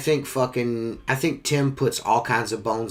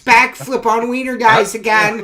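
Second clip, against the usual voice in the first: put-on tattling kid voice; 11 semitones higher, 8 dB louder than usual. Mock tattling, +11 semitones.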